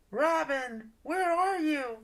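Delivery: high, thin distant calling; faraway call. Acted. Darla searching for Robin, calling from afar. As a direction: call as if from far away. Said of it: "called a faraway voice".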